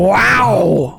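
high, put-on drawn-out wow; imitated catchphrase. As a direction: mimic an actor's signature exclamation. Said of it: "No, he says that".

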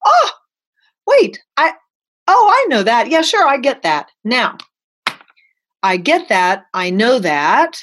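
high, excited dawning realization; sudden realization. Retold or imitated. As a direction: gasp as it clicks. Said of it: "and I would go".

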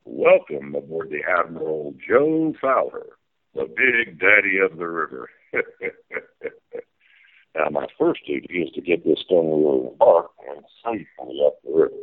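folksy tour-guide captain calling the sights; riverboat captain spiel. Pretend. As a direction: play the steamboat captain giving the tour.

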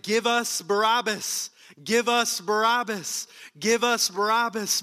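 loud, high chanted crowd cry; crowd shouting. Retold or imitated. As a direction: shout it like a riled-up mob. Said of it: "They shouted".

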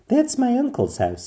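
excited little princess; Pearl's voice. A narrator's reading. Pearl pointing out the palace, excited. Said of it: "said Princess Pearl".

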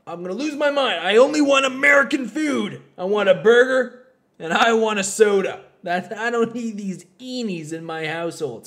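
loud, high mock dad rant; hammed-up outburst of a father losing patience; exasperated father. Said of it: "And he was just like".

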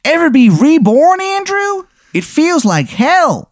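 very high-pitched cartoon character voice; Shithead Steve. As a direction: squeal it out in a put-on falsetto. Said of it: "and he goes".

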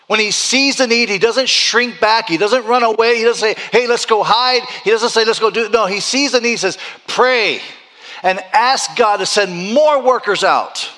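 high, loud preaching climax; preaching at full force. Acted. Pastor urging the church to pray for workers, full force. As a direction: drive it home to the congregation.

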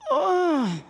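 acted groan of frustration; weary groan. Believer worn down by suffering, weary groan. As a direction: groan under the weight of it.

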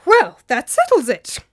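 loud, decisive exclamation; crying out. Acted. Old master reacts to the ghost story, crying out. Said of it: "cried Theopropides".

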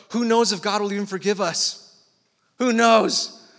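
desperate plea. Retold or imitated. Preacher voicing the praying king, desperate and loud. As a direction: cry it out in desperate hope. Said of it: "he's like".

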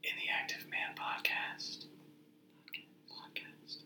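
breathy stage whisper. Pretend. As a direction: whisper the show name like a secret. Jokey plug after stay active.

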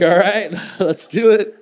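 loud, high, laughing all right; eager yes. Retold or imitated. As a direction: jump at the offer, half laughing. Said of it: "It's like".

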